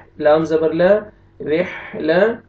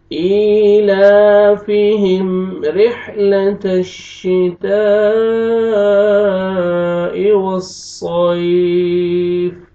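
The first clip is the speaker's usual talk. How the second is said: slow chanted ईलाफिहिम रिहलतश्शिताई, vowels held long; tajweed recitation; 3 semitones higher, 4 dB louder, much slower than usual. Shown as a demonstration. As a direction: recite it melodically, lengthening the madd. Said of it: "Hamza को आपने खींचना है".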